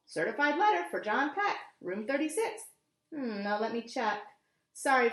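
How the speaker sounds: usual speaking voice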